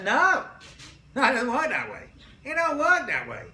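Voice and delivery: loud, high, emphatic denial. Real, not acted. Teacher firmly correcting students about anger. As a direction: shut the idea down firmly.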